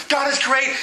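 high, keen declaration of praise; exultant psalmist. Retold or imitated. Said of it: "sometimes it's like".

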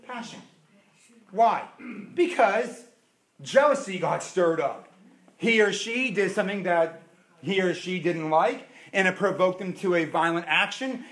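ordinary delivery

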